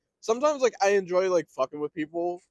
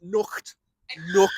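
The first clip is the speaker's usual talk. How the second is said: higher "Knocked", Scouse-style k; 3 semitones higher, 5 dB louder than usual. Shown as a demonstration. Drilling the sound for friend, Scouse accent. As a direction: isolate "Knocked" in put-on Scouse. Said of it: "it's like a k".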